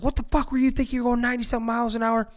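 fast, high-pitched retold rant; going off. Passenger scolds the driver over his speed, quick high rant. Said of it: "going off on him".

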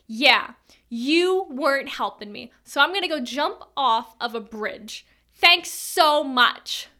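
loud, drawn-out mock accusation; hammed-up resentful outburst, slow and heavy; bitter blame. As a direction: imitate a stranger's sarcastic guilt trip. Stranger blames her, threatens, as retold.

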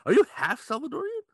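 high, loud laughing question; laughing in disbelief; surprised laugh. Real, not acted. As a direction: tease him with surprised delight.

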